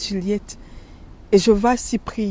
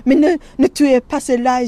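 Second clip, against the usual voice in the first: louder, pleading cry to spare them; half-shouted plea; mild retold scream to stop the killing; 4 semitones higher, 6 dB louder than usual. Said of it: "Je commence à crier, à hurler".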